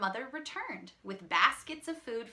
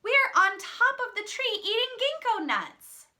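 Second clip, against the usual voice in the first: high, loud call down from a treetop; children calling out; 13 semitones higher, 11 dB louder than usual. A narrator's reading. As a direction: shout it up high like kids in a tree.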